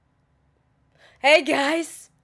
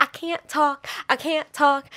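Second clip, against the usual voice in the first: usual speaking voice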